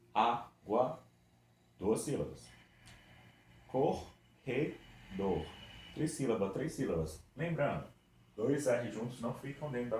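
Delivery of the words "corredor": Corredor split slowly into its syllables; separating the syllables slowly for students. Shown as a demonstration. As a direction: break Corredor apart, one beat per syllable. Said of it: "Vamos separar juntos?".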